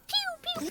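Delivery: very high voiced weapon-fire noises; high-pitched pew noises. Voice actor re-enacting the attack sound, high-pitched pews. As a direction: squeal out little pew-pew shots. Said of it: "I was just like".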